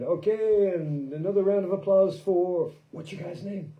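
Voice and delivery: imitating a bandleader hyping the crowd; emcee announcing. Festival ringleader announcing the band to the crowd, mock emcee voice. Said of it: "she came and said".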